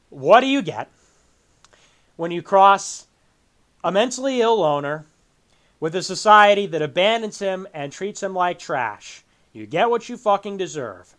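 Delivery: unmoved, matter-of-fact recital of the line; dry read-off in halting phrases. Retold or imitated. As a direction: imitate someone reading a quote without reacting. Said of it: "he just reads it off".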